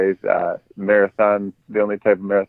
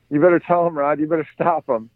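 higher-pitched imitation of an earnest kid; mock-serious kid; 6 semitones higher than usual. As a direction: warn like a boy taking it very seriously. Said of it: "He gets all serious".

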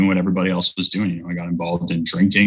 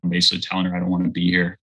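normal talking voice

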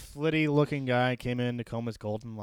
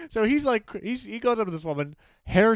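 high, loud words through laughter; laughing while telling it; 10 semitones higher, 8 dB louder than usual. Real, not acted. Friend laughing while retelling the drunk teen's antics.

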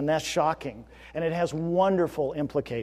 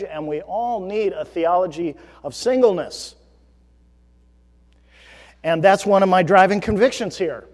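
loud, raised, emphatic sermon voice; forceful preaching; 4 semitones higher, 6 dB louder than usual. Real, not acted. Pastor pressing his driving conviction on the congregation, forceful preaching. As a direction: drive the conviction home, high and loud.

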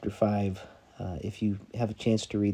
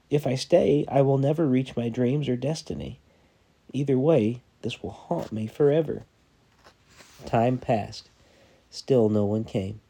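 measured storytelling read, dramatic pauses; sermon story read aloud with quoted voices; story narration; 3 semitones higher, 5 dB louder than usual. A narrator's reading. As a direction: read it like a parable to the church. Pastor reads story aloud to congregation.

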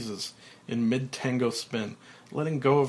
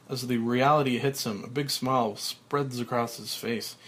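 ordinary delivery, nothing marked

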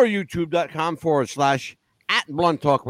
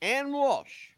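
radio sign-off; 4 semitones higher than usual. Acted. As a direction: sign off the show like a DJ. Show sign-off with nicknames.

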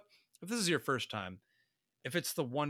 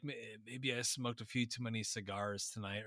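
gravelly voice; 4 semitones lower, 6 dB quieter than usual. Retold or imitated. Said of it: "slightly gravelly voice".

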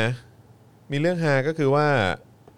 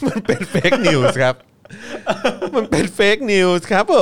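drawn-out, loud, high mock announcement; loud slow mock proclamation; 7 semitones higher, 6 dB louder, much slower than usual. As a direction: boom the phrase out slowly like a headline. Repeated louder slower for laughs. (ประกาศช้าๆ ดังๆ แบบล้อเลียน)

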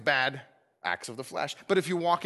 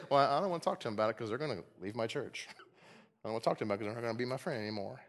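low, quick, muttered excuse; dismissive excuse; 6 semitones lower, 6 dB quieter, much faster than usual. Acted. Churchgoer avoiding a hard talk with a friend, dismissive. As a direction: brush it off softly and fast, making excuses. Acts out a person refusing to help.

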